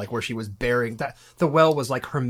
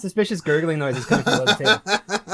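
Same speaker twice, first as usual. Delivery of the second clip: laughing; 4 semitones higher than usual. Real, not acted. Joking about the coffee noises.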